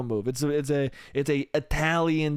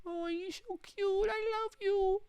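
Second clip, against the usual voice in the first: very high, soft squeaky adoration; hammed-up lovey-dovey falsetto; baby-talk cooing; 8 dB quieter, slower than usual. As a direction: squeak it slowly, melting with mock love.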